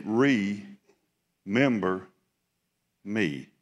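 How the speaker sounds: stretched very slow remember, pleading; the thief on the cross begging, slow and drawn out; drawn-out plea. Shown as a demonstration. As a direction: draw out Remember like a dying man's plea. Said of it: "the thief on the cross that said".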